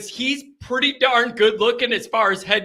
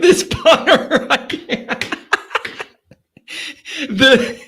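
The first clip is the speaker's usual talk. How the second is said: loud, helpless laughing; cracking up; 2 semitones higher, 4 dB louder than usual. Real, not acted. Host cracking up over the new punter, helpless laughing. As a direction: talk through a laughing fit.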